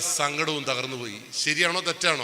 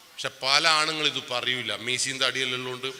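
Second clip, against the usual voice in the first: normal talking voice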